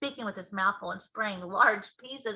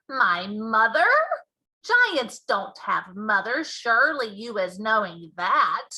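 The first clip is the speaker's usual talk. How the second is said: big friendly giant, louder and slower; BFG giant voice; 7 dB louder, slower than usual. Acted. Giant explaining to Sophie, loud and slow. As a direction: boom out the giant's mangled grammar.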